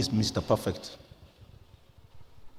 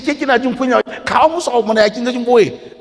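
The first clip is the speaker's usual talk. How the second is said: high, raised scolding shout; retold shout; 10 semitones higher, 6 dB louder than usual. Husband retells shouting at his wife in public, scolding. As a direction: scold her loudly in a high voice. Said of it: "And I shouted".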